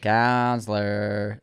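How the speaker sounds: imitating Max Cady to his co-host, low and slow; low slow Counselor. Repeating the villain line lower.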